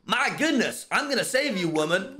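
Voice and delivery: put-on over-the-top American accent; fake American accent. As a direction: play a cartoonish American host. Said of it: "I need to be less British".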